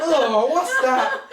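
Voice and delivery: a slow, loud, laughing mock shriek of disgust; mock scream. Retelling the diners tasting burnt turkey, mock screaming. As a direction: stretch it out loud through laughter. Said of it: "All you hear is everyone screaming".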